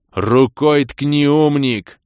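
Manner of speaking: loud, high, exasperated and drawn-out; near-shout. A narrator's reading. Hero instructs the clueless elf, nearly shouting. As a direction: snap slowly, on the edge of yelling. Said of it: "Едва не сорвался я на крик".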